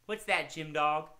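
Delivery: usual speaking voice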